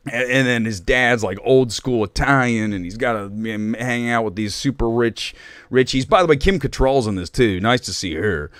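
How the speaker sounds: normal talking voice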